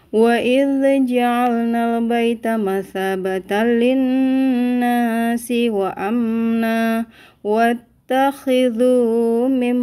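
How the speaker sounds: brisk flowing tajwid recitation; Quran recitation. Acted. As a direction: chant the verse in a steady flow.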